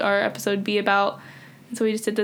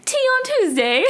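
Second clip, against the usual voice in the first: very high mock British accent; hammed-up, high-pitched British lilt; sing-song attempt at a British accent; badly faked British accent. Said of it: "I'm so bad at this".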